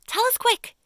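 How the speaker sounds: very high, loud urgent cry; a worm crying out for the plan; eager cry. Acted. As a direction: demand it at once. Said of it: "cried the earthworm".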